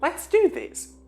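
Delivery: high, eager self-encouragement; excited. A narrator's reading. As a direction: pump yourself up. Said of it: "the excitement growing in her voice".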